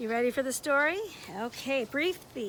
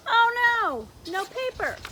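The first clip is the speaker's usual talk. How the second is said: dismayed; 9 semitones higher, 7 dB louder, slower than usual. Acted. Leon dismayed.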